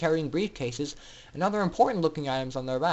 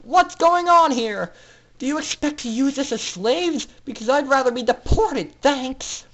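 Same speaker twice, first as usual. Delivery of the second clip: high, loud defiant shout; yelled; 10 semitones higher, 6 dB louder than usual. Acted. Scootaloo yelling defiantly at the doctor, much higher. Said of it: "Scootaloo yelled".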